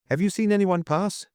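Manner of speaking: high-pitched called question; calling out. A narrator's reading. Duke asking the cloakroom attendant, calling out. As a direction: call across, urgent question. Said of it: "called out the duke".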